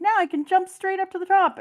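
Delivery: high, loud triumphant cry; excited anime hero. Retold or imitated. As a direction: call it out full of joy. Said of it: "he's like".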